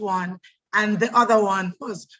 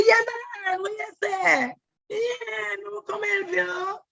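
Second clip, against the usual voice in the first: Sophia voice; 11 semitones higher than usual. Retold or imitated. Voicing Sophia the go-go dancer.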